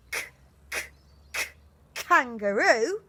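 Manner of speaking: k, k, k, kangaroo with a crisp k each time; phonics sound k. Shown as a demonstration. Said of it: "we're going to do it like this".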